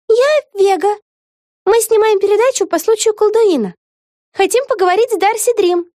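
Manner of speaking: put-on high, bright young voice; lightly voiced young witch; girl character voice. A narrator's reading. Вега introduces herself. (высокий девичий голос)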